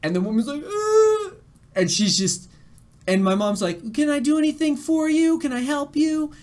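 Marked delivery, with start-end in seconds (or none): 0.6-1.3 high-pitched imitated sob; mock crying wail. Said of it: "the woman's just crying and crying". 3.9-6.3 high, solicitous cooing voice; very nice mom. Retold or imitated. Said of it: "like, being so nice".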